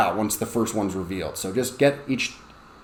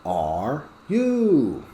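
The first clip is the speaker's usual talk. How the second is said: very slow, sing-song how are you; sing-song drill; much slower than usual. Shown as a demonstration. Teacher drilling the class, slow sing-song. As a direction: draw out you in a singing drill. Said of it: "this one should be".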